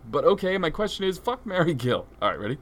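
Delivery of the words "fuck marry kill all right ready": ordinary delivery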